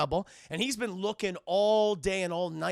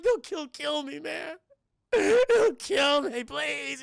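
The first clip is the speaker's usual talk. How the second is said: high, desperate pleading wail; terrified begging; 7 semitones higher, 5 dB louder, much slower than usual. Retold or imitated. Tortured man pleading with Batman, terrified begging. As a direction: beg for your life, voice pitched way up.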